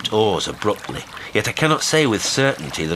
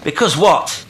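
loud, high prompting question; quick demand; 11 semitones higher, 7 dB louder than usual. A narrator's reading. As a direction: throw the question straight back. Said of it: "I asked quickly".

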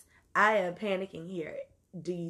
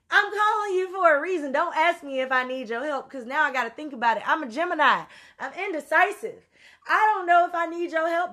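higher and louder, demanding; exasperated rant; 6 semitones higher, 4 dB louder than usual. Real, not acted. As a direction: scold the friend for making you ask. Ranting at friend who asked.

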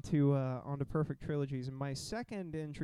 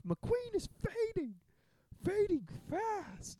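podcaster re-enacting the race scene, high drawn-out announcer cry; high-pitched, slowly stretched mock commentary; excited race announcer; much slower than usual. Retelling Cars three to his friends, excited announcer imitation. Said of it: "That scene where it's like".

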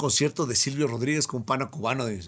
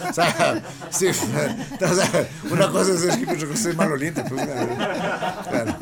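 talking through real laughter, louder and higher; laughing; 4 semitones higher, 5 dB louder, slower than usual. Real, not acted. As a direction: crack up while making the point. Joking about smelly hippies, laughing. (hablando entre risas)

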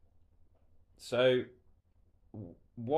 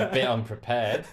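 laughing; 2 semitones higher, 8 dB louder than usual. Real, not acted. Embarrassed laugh while admitting.

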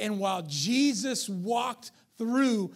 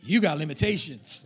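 mean way to say it; 7 semitones lower than usual. Retold or imitated. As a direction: imitate the congregation's mean jab, laughing. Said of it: "Some of you got mean about it".

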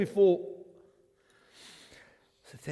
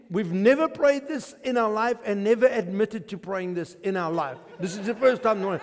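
usual speaking voice

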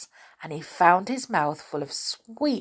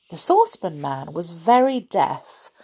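usual speaking voice

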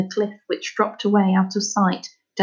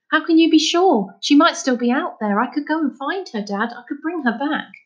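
pleading with Dad in a rush of hope; boy begging his father to let him find her, eager; 7 semitones higher than usual. Acted. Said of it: "Finn said eagerly".

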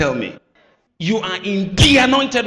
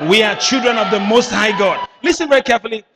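loud and higher; preacher rallies the congregation loudly; preaching shout; 6 semitones higher, 7 dB louder, faster than usual. Real, not acted. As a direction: proclaim it with force. Preaching at full force.

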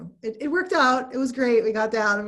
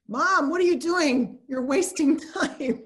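higher, louder imitation of an impatient son; retold nagging from a son, ending drawn out; son's complaint; 7 semitones higher, 5 dB louder than usual. Son nagging his mom to get moving, mock-impatient. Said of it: "my son said again".

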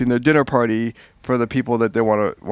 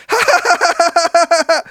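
much higher, clipped-free ha-ha laugh imitation; imitating aunt's laugh; 6 dB louder than usual. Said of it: "That's exactly how she laughs".

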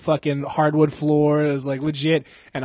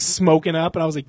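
ordinary talk, nothing marked

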